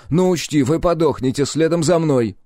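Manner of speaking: high-pitched, heated warning; defiant challenge. A narrator's reading. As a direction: push it up high as a threat. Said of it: "с вызовом бросил Сальф".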